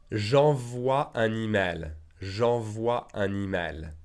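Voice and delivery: slow, clear teacher model sentence; deliberate classroom pace; slow model reading. Shown as a demonstration. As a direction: say it slowly for students to repeat. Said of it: "teacher model sentence, slowed".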